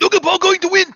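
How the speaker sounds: high, loud mock shriek; sudden over-the-top high scream. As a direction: yell it out in a screechy burst. Mimicking the strained outburst, yelling.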